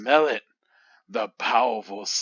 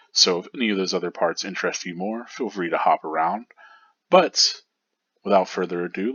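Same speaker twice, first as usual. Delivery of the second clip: ordinary talk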